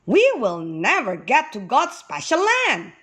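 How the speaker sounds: high, swooping whine; complaining. A narrator's reading. Weary walker despairing of reaching the land, high complaining. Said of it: "Everyone started complaining".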